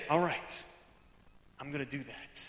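low, hushed self-promise; quiet resolve. Acted. Congregant resolving after a sermon, low and soft. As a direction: sink down as if deciding privately.

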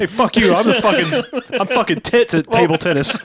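cocky trash talk, laughing. Pretend. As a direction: brag and taunt like a jerk. Danny McBride Gump taunting.

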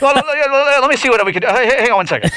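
high, stuttering mock salesman; flustered phone rep. Phone rep stalling customer who declines, mock flustered. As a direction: scramble to keep the caller on the line.

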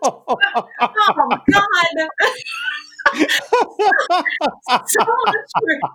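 high, loud words breaking up with laughter; helpless laughter. Real, not acted. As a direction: gasp it out through real laughter. Laughing at own embarrassing story.

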